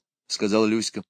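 usual speaking voice

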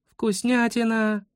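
put-on high, slow savouring little girl; relishing child. A narrator's reading. Schoolgirl remembers the candy, drawn-out relish.

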